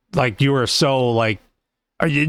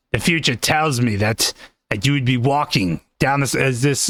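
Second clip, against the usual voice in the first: ordinary talk, nothing marked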